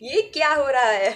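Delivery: drawn-out, hammed-up baby, rising to a laughing outburst; a touch of theatrical shock with a laugh at the end; mock dramatic self-talk. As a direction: play up your own disbelief, half laughing. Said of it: "मुझे लगता है कि".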